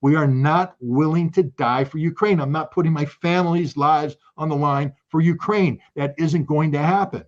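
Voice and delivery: a defiant crowd calling out, pitch up. Pretend. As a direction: call it out like a protest. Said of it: "need to shout down".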